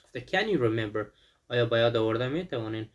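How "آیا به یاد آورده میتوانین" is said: ordinary talk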